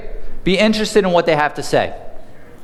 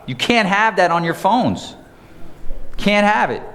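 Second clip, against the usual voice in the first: ordinary talk, nothing marked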